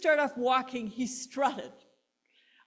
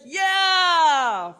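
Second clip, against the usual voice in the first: loud, high cheering yell; roaring crowd answering the daredevil; 6 semitones higher, 10 dB louder than usual. Retold or imitated. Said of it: "everybody said".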